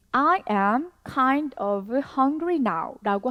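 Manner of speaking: slow, careful English model sentence; each word said slowly for learners; slow model reading. Shown as a demonstration. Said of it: "I am hungry now"라고만 한다면". (천천히 또박또박 읽는 예문)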